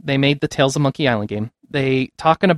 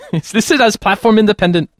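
higher mock Indian accent, laughing; 5 semitones higher than usual. Shown as a demonstration. Host's accent drifting from Chinese, now mock Indian. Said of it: "Now it's Indian".

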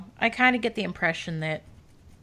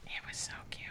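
breathy whisper, no voice; 18 dB quieter than usual. Real, not acted. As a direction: whisper it softly. Whispered aside.

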